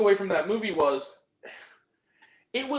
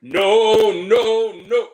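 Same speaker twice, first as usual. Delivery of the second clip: very loud repeated no; answering a friend's question with a loud no; emphatic refusal; 2 semitones higher, 12 dB louder, much slower than usual. Pretend. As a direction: shout each no flat out. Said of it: "Ask me what I should say".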